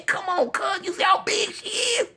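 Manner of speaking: mock-crying at his friend, high pitched; anguished whine. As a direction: whimper it in mock despair. Aghast plea to friend.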